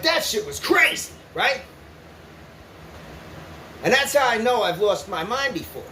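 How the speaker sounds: usual speaking voice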